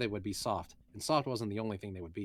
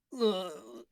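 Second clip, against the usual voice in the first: groan; 12 semitones higher, 3 dB louder than usual. Acted. Eris groans.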